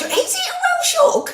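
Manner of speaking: very high-pitched squeal of surprise; real surprise, voice shooting right up; astonished. Real, not acted. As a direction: squeak it out in disbelief. Learns the odd word is real.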